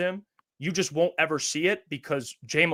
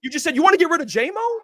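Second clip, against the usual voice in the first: incredulous; 11 semitones higher than usual. Real, not acted. Incredulous during heated argument.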